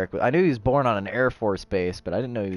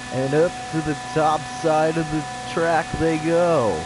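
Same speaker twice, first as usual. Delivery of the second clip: race commentator; 7 semitones higher than usual. Acted. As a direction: hype up the finish like TV commentary. Race play-by-play.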